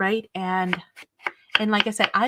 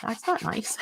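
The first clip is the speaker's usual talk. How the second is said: mock aside; 3 semitones higher, 6 dB quieter than usual. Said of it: "and I'm like".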